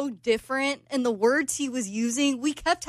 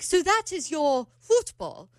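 put-on slow, higher British accent; 4 semitones higher, slower than usual. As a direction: imitate a British man working it out. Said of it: "[name]'s doing with the accent".

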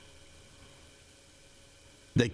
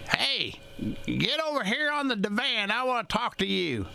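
high-pitched mock grandma summons; grandmother calling out; 10 semitones higher than usual. Grandmother orders grandchild over to the divan, high mock call.

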